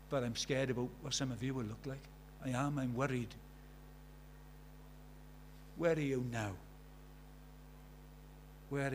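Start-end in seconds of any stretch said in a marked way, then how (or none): none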